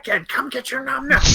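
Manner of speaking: high, put-on mom calling her son; shrill-free high mother voice yelling for dinner. Voicing Mark's mom calling him.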